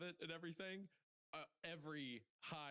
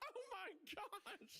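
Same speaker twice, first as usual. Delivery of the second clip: mock terrified screaming; much slower than usual. Imagined pilot screaming to himself in the cockpit, hammed-up terror. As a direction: scream it in comic terror, slow and high. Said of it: "just screaming to himself".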